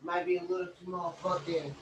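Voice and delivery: ordinary talk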